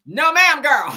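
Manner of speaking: high, loud laughing refusal. Real, not acted. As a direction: burst out laughing.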